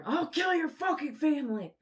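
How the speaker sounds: shrieked high and loud, a crazed stranger; mock screaming threat. Lady on the beach yelling behind them, high screaming threat. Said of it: "this lady's, like, just, uh, freaking out".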